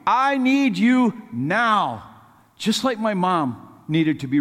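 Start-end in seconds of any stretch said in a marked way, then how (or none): 0.1-2.0 urgent plea, higher pitch, slowing on the last words; acted desperate prayer for help; crying out to God. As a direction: plead up to God, drawing out the final line. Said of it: "he's crying out to God".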